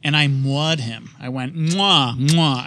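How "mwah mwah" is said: two kissing mwah sounds; smooch. Retold or imitated. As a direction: air kiss, cheek then cheek. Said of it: "I actually made the mwah sound".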